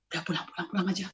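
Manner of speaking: low, soft coaxing; hushed urging. Retold or imitated. Mother calming child before father angers, hushed.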